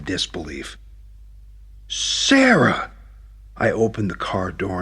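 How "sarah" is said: a high, loud call across the park. Acted. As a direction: shout her name to stop her. Spotting her from the car.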